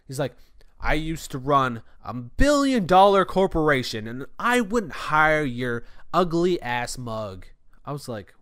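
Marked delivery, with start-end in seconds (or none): 0.8-7.5 loud, drawn-out insult in a mock customer voice; imitated contemptuous rant. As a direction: act out an irate customer, stretching the words. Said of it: "literally said it just like that".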